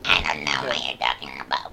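breathy whispered aside. Real, not acted. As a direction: say it under your breath.

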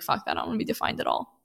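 fast whispered rebellious quip; hushed imitation of a defiant guest; whispered mock retort. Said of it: "or you're like".

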